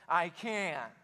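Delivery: lightly voiced whining man; a hint of a put-on whine; whiner. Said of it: "That's how he said it".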